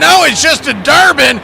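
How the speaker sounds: ordinary talk, nothing marked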